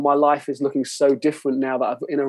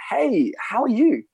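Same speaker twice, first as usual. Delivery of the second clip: cheery greeting; 8 semitones higher, slower than usual. Shown as a demonstration. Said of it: "can always be a".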